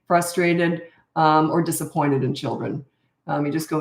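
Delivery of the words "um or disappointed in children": ordinary talk, nothing marked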